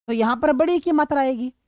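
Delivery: ordinary talk, nothing marked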